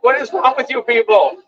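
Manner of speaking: high, disbelieving retort; incredulous demand, pitched high; exasperated outburst. Retold or imitated. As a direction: throw it at them in disbelief. Facing protesters screaming again.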